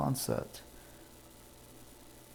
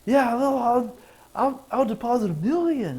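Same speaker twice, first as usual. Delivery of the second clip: much higher, louder eager voice; eager customer; 10 semitones higher, 5 dB louder than usual. Retold or imitated. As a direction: stammer with excitement.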